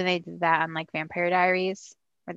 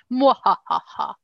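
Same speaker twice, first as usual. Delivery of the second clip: slow, louder evil cackle; sneering panto villain laugh; 5 semitones higher, 4 dB louder, slower than usual. Pretend. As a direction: ham up the villain's mwahaha. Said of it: "I'm gonna try it again".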